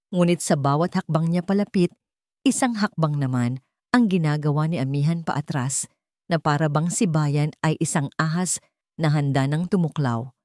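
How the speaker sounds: plain reading voice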